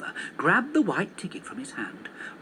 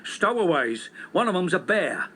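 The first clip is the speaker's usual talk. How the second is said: a lightly voiced policeman calling out; mild mock shout; 3 semitones higher, 3 dB louder than usual. Policeman calling out to the others about a bear. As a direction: call it out like an excited constable. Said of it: "shouted another policeman".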